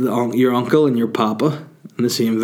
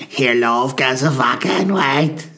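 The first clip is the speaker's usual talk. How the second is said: drinker voice. Retold or imitated. Imitating vodka drinker.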